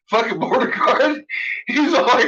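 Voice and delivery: high-pitched, cracking up mid-story; real helpless amusement; laughing while talking. Real, not acted. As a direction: squeeze the words out through laughter. Teller cracking up at his own story.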